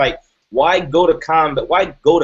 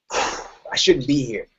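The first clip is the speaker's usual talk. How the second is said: emotional; 2 semitones lower, 3 dB quieter, much slower than usual. Real, not acted. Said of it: "Forgive me if I get emotional".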